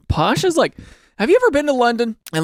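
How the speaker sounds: ordinary delivery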